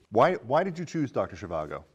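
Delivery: normal talking voice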